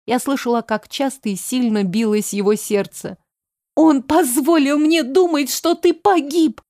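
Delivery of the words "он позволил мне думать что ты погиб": high-pitched, agitated outpouring; distraught reproach. A narrator's reading. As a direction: let the hurt spill out, pitch raised. Distraught reproach during the embrace. (отчаянный, обиженный возглас)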